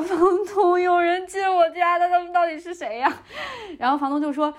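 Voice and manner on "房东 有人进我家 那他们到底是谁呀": imitated panic. Tenant telling landlord about intruders, imitated panic. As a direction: replay a frantic cry for help.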